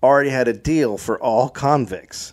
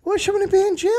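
high-pitched prisoner voice; faster than usual. Retold or imitated. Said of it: "You're like".

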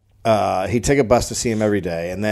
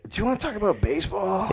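stoned; 9 semitones higher, 5 dB quieter than usual. Acted. Acting stoned Glenn.

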